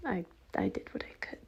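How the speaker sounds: fast, hushed whispered excuse; defeated whisper. Acted. Bob answering the angry partner, whispered. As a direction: whisper it, beaten down. Defeated reply after being yelled at.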